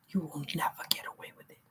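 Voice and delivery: hushed, fast whispered threat; menacing whisper. Real, not acted. Warning wrongdoers they won't escape, whispered. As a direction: whisper it like a curse.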